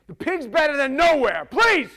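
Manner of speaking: loud, high, frantic begging; desperate plea. Acted. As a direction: beg for your life. Demons begging for the pigs.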